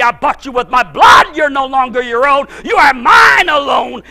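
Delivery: preacher voicing God ordering him to die to self; God commanding. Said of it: "what if God would ask you".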